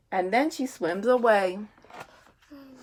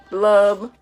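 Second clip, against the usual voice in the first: louder sulky blub blub blub; glum fish blubs; 3 semitones lower, 4 dB louder, much slower than usual. Acted. Reading to a child, the sulky fish blubs loudly. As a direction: thud out each blub with a sulk.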